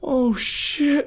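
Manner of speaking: high, frightened yelp; panicked under fire. A narrator's reading. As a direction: yell it in sheer panic. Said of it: "yelled Lampus".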